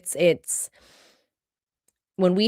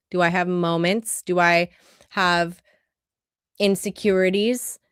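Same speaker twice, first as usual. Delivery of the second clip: usual speaking voice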